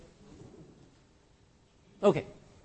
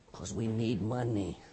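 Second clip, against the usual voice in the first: low aside; 10 semitones lower, 8 dB quieter than usual. Real, not acted. Punchline dropped low.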